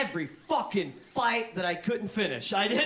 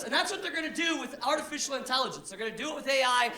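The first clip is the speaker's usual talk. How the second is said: usual speaking voice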